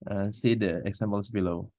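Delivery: usual speaking voice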